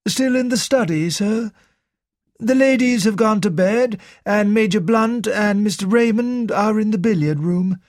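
a hint of a bewildered servant; lightly voiced butler. A narrator's reading. Narrator voices butler Parker.

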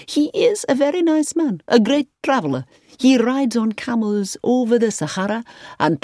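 high-pitched, chatty host; effusive hotelier Marcus. A narrator's reading. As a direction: talk up the guest in a high, emphatic voice. Marcus describes the famous traveller.

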